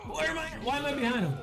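confused high yelp. Real, not acted. Gamer confused mid-fight.

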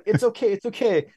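high-pitched fan insisting it's fine; eager reassurance. Retold or imitated. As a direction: brush the objection aside, giddy and high. Re-enacts eager fan insisting on hug.

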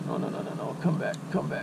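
whispered no no, begging it back; pleading whisper. Real, not acted. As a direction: beg the fish in a hush. Fish swimming away, pleading.

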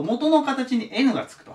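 ordinary delivery

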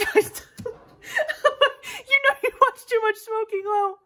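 high, loud laughing voice. Real, not acted. Vlogger cracking up at her own borrowed outro, laughing. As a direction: squeal it out through real laughter.

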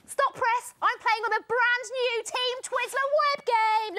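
high, loud breaking-news proclamation; news flash announcement. Pretend. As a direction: interrupt with a big announcement. Mock news flash announcing new game.

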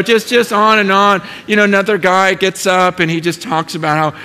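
ordinary talk, nothing marked

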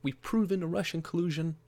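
high mocking imitation of gleeful reporters; mocking gleeful media. Vlogger mocking the media celebrating Trump's downfall, high gleeful mock voice. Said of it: "They're like".

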